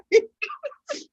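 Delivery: high, gasping laugh; high squeal laugh. Real, not acted. Laugh after the joke.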